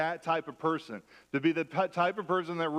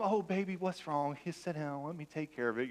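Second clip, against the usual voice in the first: put-on softer, gentle mother soothing a hurt child; doting mom; 5 dB quieter than usual. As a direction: coo it, fading softer as you go. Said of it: "'Cause your mom".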